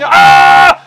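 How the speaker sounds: loud, full-throated acted scream; falling scream. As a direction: scream as if tumbling from the sky. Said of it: "tumbling and tumbling and screaming".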